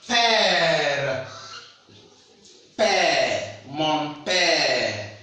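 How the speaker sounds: "père" with a long open "eh", gliding down; open è sound. Shown as a demonstration. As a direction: stretch the vowel of "père" wide open, as in "air". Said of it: "It pronounced like E. As in".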